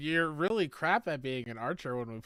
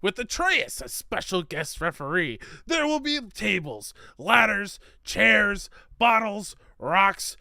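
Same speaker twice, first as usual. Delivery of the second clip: loud hyped wrestling announcer; wrestling promo voice; 3 semitones higher, 7 dB louder than usual. Pretend. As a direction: bellow it like a pay-per-view hype man. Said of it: "Insert wrestling promo voice here".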